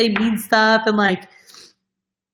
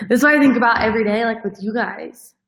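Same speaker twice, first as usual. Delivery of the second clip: getting emotional; 2 semitones higher than usual. Real, not acted. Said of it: "I'm gonna get so emotional".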